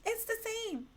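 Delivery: high, soft mimicking voice; high-pitched imitation of a stubborn friend; friend insisting. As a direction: quote her in a high, small voice. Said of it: "She's like".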